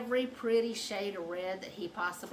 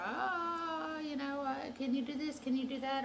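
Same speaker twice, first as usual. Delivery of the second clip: higher-pitched hesitant questioner; imitating curious people; 7 semitones higher than usual. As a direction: lightly voice nosy questions, pitched up.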